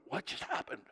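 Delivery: breathy whisper of confused angels; whispered bewilderment. Pretend. Angels in heaven watching people, baffled whisper. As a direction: hush it like stunned onlookers.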